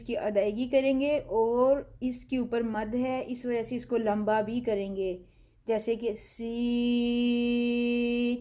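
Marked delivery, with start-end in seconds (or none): 6.2-8.4 سین with the vowel stretched long; lengthened letter. Shown as a demonstration. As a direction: draw out سین for two and a half counts. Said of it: "دو اڑائی الف کے برابر".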